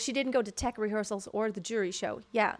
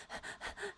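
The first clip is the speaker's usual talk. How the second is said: rapid shallow gasps, imitated; snatch several little breaths in a row; short gasping breaths; 14 dB quieter than usual. Coach showing a singer's short breaths, quick gasps. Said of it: "short breaths often".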